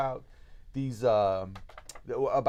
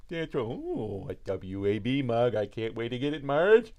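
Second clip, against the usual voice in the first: Homer's excited, drawn-out voice; Homer Simpson; 5 dB louder, slower than usual. Retold or imitated. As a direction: do Homer drooling over the mug. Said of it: "I kinda do a Homer Simpson".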